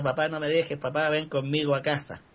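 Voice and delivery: normal talking voice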